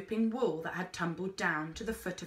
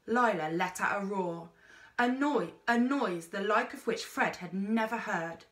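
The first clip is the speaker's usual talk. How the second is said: ordinary delivery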